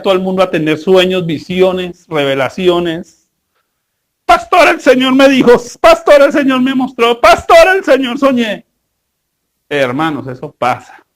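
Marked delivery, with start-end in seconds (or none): 4.3-8.6 high, louder, breathless pleading "Pastor"; hammed-up believer rushing to tell the pastor; a believer tugging at the pastor's sleeve with visions, in a high excited mock plea; mock eager church member. Congregants claiming visions to pastor.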